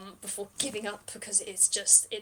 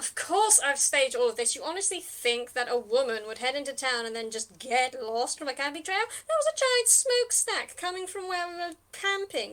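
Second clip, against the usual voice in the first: indignant, climbing higher as it goes; indignant retort; 5 semitones higher, 4 dB louder than usual. Acted. Captive Julianne scoffs at her questioner's assumption, indignant. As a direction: build into incredulous scolding.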